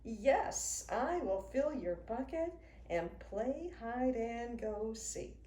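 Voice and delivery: usual speaking voice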